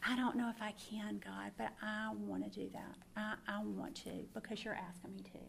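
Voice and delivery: soft, breathy and uncertain, near a whisper; humbled quiet confession; hushed reply to God. Retold or imitated. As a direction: answer God quietly, half unsure but willing. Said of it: "And I went".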